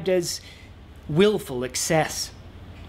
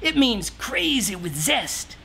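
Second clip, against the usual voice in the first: easygoing, grooving hippie voice, higher in spots; mellow hippie shaman; 4 semitones higher than usual. Acted. Shaman telling the king to relax and dress wild, mellow. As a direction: sell the wild clothes like a chilled-out guru.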